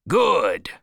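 high, loud cry of approval. Acted. Captain pleased with the crew's answer, crying out. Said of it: "cried Ahab".

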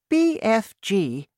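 high-pitched queenly voice; regal Queen. A narrator's reading. Queen calling the BFG's attention, high and regal.

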